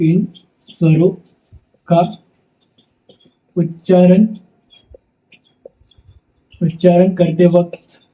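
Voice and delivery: word by word with long pauses, for writing down; slow dictation. Shown as a demonstration. Teacher dictating notes word by word to students, slowly. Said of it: "teacher dictating slowly word by word".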